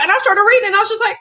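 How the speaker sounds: ordinary delivery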